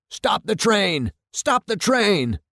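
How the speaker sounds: high, drawn-out mock shout; slow, high-pitched shout at restrained volume. A narrator's reading. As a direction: call out urgently, stretching each word. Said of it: "Clumley's shouts come through clearly now".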